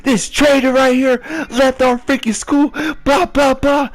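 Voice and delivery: high, loud mock outrage; hammed-up team yelling traitor; mock angry teammates.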